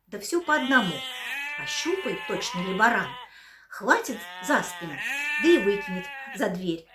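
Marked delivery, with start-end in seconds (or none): none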